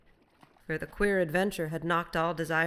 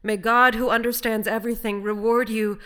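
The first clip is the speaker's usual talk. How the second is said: loud, raised, earnest blessing; fervent blessing; 5 semitones higher, 11 dB louder than usual. A narrator's reading. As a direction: bless them fervently across the water. Castaway fervently blesses his helpers.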